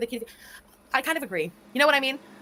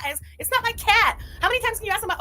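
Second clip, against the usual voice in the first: high, loud exasperated protest; 9 semitones higher, 6 dB louder than usual. Real, not acted. Host fed up with viewers' cat requests, exasperated. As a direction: burst out, fed up.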